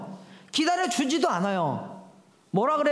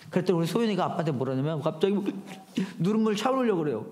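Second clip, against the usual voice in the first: usual speaking voice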